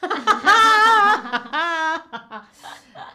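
loud helpless laugh at own drawing; loud laughter. Real, not acted. Student laughs loudly at her own clumsy drawing, loud burst of laughter.

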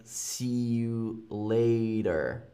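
slow American "later" with a soft D; American accent. Shown as a demonstration. Teacher demonstrates American "See you later" with a D sound. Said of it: "with more of a D".

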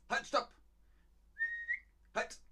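high, abrupt barked command; aggressive. Shown as a demonstration. Teacher shows the bare imperative, aggressive high command. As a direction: halt them with a sharp order. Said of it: "Halt ist aggressiv".

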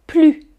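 "plus" said "ply", final S silent; plus without S. Shown as a demonstration. Teacher shows the first way to say it. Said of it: "Le premier".

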